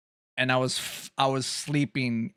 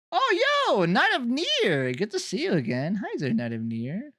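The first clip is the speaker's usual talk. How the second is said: high put-on voice sliding back down; sudden voice change; 8 semitones higher than usual. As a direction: leap into a very high greeting. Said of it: "That voice change is wild".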